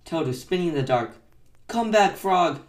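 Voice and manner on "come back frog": loud, high pleading call; raised, higher desperate cry; shouted plea. A narrator's reading. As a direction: shout after a lost friend. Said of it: "he shouted".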